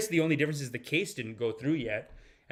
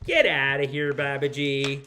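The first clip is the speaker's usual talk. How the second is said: loud, playful dismissal; mock shooing; 6 dB louder than usual. Host sending his sidekick away, loud mock scolding. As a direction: shoo off a pesky sidekick with a burst of volume.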